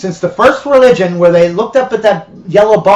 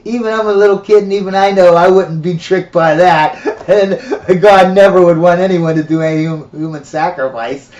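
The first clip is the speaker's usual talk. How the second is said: put-on laughing little boy. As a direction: giggle through the words as a kid. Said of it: "the little boy was laughing".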